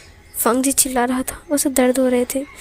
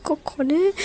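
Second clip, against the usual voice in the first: nervous stammer; 4 semitones higher, 4 dB quieter, slower than usual. Acted. Swallowing nervously at the knock.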